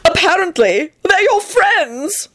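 very high, drawn-out accusation; triumphant. A narrator's reading. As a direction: land the accusation triumphantly, stretching it out. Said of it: "he added triumphantly".

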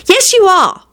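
high-pitched sibling retort; insistent contradiction. Acted. Siblings quarrel back and forth.

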